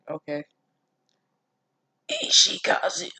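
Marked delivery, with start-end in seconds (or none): 2.1-3.1 breathless unvoiced whisper of a wounded boy; dying boy's whisper. Reader voicing the wounded boy, whispered name. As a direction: rasp the name out like he's bleeding out. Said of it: "that's how he would sound".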